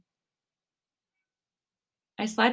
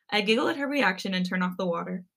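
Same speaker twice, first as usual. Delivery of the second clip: plain reading voice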